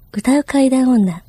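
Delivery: slow, hushed eerie intro; ghost story telling. Acted. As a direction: whisper your name slowly. Dramatic self-introduction.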